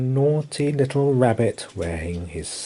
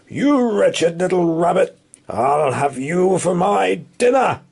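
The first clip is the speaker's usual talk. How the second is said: higher, louder growling farmer; 8 semitones higher, 4 dB louder than usual. Acted. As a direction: growl at the rabbit. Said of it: "growled Mister McGregor".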